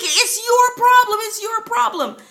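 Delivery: high, loud mock scream of an angry neighbor; imitated screaming. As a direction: throw the blame back, imitating his yell. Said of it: "he's screaming at my husband".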